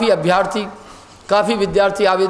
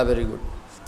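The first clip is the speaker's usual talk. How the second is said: hushed praise; 12 semitones lower, 10 dB quieter, much slower than usual. Acted. Master answers the deep meditator.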